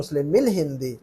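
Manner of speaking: short "mil" running into hindi in मुस्लिमिल; joined reading. Shown as a demonstration. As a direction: model मुस्लिमिल हिंदी as one phrase. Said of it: "जल्दी से पढ़ेंगे".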